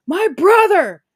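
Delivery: high, loud dramatic lament; imitated anguished outburst. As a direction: cry out over a lost brother, hammed-up. Acting game character's grief outburst.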